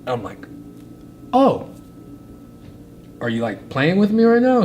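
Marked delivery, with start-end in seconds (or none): 1.3-4.6 high, loud, stunned question; can't believe what he's hearing; disbelief. Retold or imitated. Stunned reply to good news.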